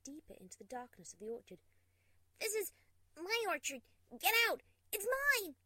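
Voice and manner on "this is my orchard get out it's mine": furious shout. Acted. Screaming at intruder.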